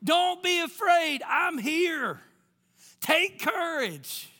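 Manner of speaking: high, loud shout as the Lord; urgent calling out. Acted. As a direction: holler reassurance over the waves, stretching the words. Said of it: "He's been trying to speak this over you".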